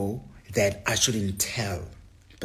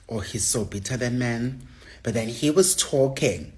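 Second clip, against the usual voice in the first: ordinary talk, nothing marked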